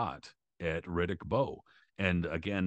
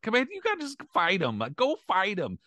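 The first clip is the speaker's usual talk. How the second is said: much higher, louder pleading call; imitating trainer's plea; 12 semitones higher, 5 dB louder than usual. Trainer begging his fighter to fight. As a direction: urge him on from the corner. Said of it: "He was like".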